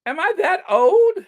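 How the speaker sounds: high, loud mock disbelief; mock offended. Squealing mock offence at a seniors' gala invite. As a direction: squeal it as if insulted.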